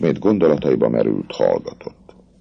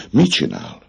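ordinary delivery

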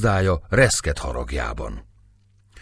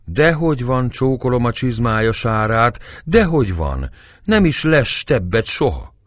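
higher-pitched, obsequious character voice; 6 semitones higher than usual. Acted. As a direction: play a humble, flattering old acquaintance.

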